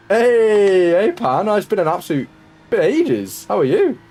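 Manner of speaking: high and loud delighted hello; excited greeting. Real, not acted. Streamer greeting Pan, who's been away for ages, excited and loud.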